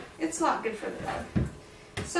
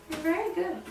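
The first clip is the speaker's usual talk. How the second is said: higher and softer, "very" lifted fondly; soft high praise to a dog; 5 semitones higher, 5 dB quieter than usual. Shown as a demonstration. Trainer praising the dog, soft high praise. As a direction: praise the dog gently and sweetly. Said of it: "tell them they're very good".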